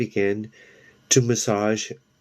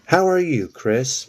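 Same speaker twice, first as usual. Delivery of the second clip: high, eager hello; a higher, louder hello to an old friend; bright greeting; 5 semitones higher, 3 dB louder than usual. Acted. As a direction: greet her with a lift in the voice. Sue greets Chris brightly.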